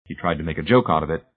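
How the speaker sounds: ordinary delivery, nothing marked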